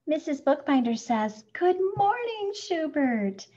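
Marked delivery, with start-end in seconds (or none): 1.5-3.5 high singsong hello; cheery greeting. A narrator's reading. Teacher greets Schubert at school, cheery singsong. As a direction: greet high, then glide down on the name.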